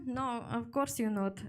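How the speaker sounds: ordinary talk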